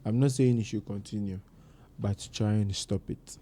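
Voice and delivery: low, softening motherly advice; relatable gentle scolding. Retold or imitated. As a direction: reason with them quietly, as mom would. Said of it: "she scolds you in a very relatable way".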